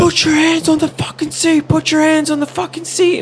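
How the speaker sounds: retold high-pitched urgent police shout; yelling cop. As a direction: imitate a cop screaming orders at gunpoint. Retold cop with gun drawn, ordering.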